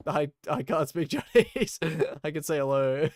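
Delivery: a touch of embarrassed modesty; sheepish reply. Retold or imitated. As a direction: brush off the praise with a laugh. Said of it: "I'm like".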